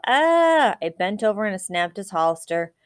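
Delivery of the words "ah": high, loud strangled yell; wordless panicked shout; desperate yell. A narrator's reading. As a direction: cry out trapped and furious. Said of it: "All he could do was yell".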